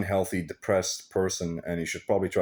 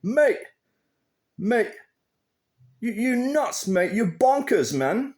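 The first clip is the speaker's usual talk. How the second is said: high, hammed-up English lad; mock English bloke telling him he's bonkers; exaggerated English accent, slow and pitched up; 4 dB louder, slower than usual. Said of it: "I'm gonna switch to English".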